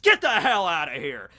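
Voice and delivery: loud mock yell of a furious dad; angry father yell. Izanagi kicking Susanoo out, loud angry yell. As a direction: throw him out with a loud bark.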